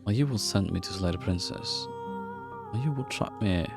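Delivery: ordinary talk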